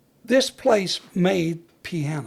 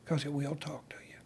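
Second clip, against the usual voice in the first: softer whisper, trailing away; whispered warning; 3 semitones lower, 13 dB quieter than usual. Acted. Firefighter warns you must listen to fire, soft whisper. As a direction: let it fade to a hush. Fire talks, hushed for effect.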